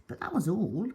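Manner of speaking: ordinary delivery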